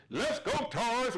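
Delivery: high, drawn-out imitated call; mock heckler's cheer, stretched out. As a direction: call it out slowly like an uncle in the crowd.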